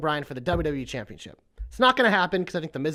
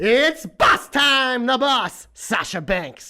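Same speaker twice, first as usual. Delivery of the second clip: ring announcer; 3 semitones higher, much slower than usual. Pretend. Announcer-style wrestler introduction.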